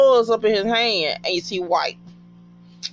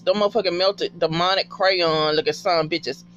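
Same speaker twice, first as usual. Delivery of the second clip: ordinary talk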